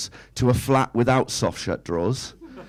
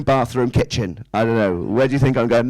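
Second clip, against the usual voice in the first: ordinary talk, nothing marked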